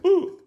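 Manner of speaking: high-pitched little "ooh" of waking; sudden squeaked wake-up noise; startled waking "ooh". Retold or imitated. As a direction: imitate someone suddenly woken from a nap. Mimics nan waking up suddenly.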